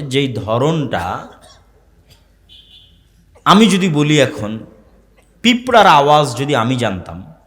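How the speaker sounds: emphatic Bengali sermon voice; sermon preaching. Acted. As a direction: preach it to the gathering.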